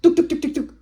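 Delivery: punchy loud "duk" beats; running footsteps. Retold or imitated. Man running toward Julia, imitated footstep sounds. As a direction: tap out his sprint with your voice.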